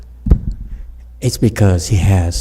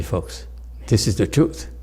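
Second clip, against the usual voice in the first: ordinary delivery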